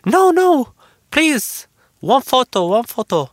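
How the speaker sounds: very high, loud begging fan voice; pleading fan. Retold or imitated. Fan woman begging for a photo, very high and loud. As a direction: beg like an excited fan.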